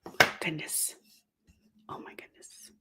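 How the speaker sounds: hushed whisper, caught off guard; startled aside, barely voiced; surprised whisper. Real, not acted. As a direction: whisper it to someone off to the side. Distracted mid-recording, aside off mic.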